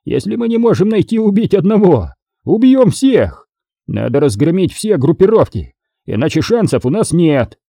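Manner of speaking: high-pitched, furious tirade; angry yell. A narrator's reading. Boss demands war on every gang, angry yell. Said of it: "заорал Харрисон".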